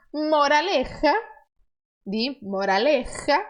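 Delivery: moraleja with a strong raspy J. Shown as a demonstration. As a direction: push air hard through the j in moraleja. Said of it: "Colocando força nessa letra J".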